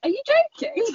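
high incredulous "Are you joking?"; incredulous retort. Retold or imitated. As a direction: pitch it way up in disbelief. Retold retort on train.

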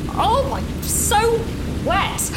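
drawn-out whine. Acted. Drenched in the rain.

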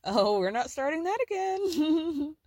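sugary fake-sweet, slow; fake-smile brush-off. Kris to Chloe, brushing her off with a fake smile. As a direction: smile it on and draw it out. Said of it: "puts on this very fake smile".

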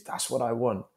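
ordinary talk

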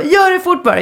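high-pitched, harried parent; impatient hurrying. Retold or imitated. Parent rushing son to brush teeth, impatient. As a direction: hurry the child along urgently, pitched right up.

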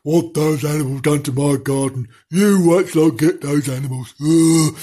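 put-on grumbling old misery of a gardener; put-on grouchy voice; miserable gardener. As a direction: grumble through it on a dull monotone. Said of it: "Mister Miserable".

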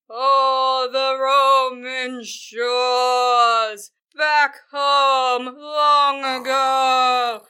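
loud, extremely drawn-out tragic declamation; laboured droning. Acted. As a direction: drone every syllable like a terrible stage tragedian. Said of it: "he's droning".

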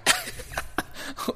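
squeaky breathless giggle; a high unvoiced burst of laughter; tiny wheezing laugh. Real, not acted. Laughing at his own story.

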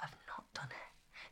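tiny, slow whispered confession; retold guilty whisper. Pupil confessing unfinished coursework to teacher, guilty whisper. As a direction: barely dare to whisper it.

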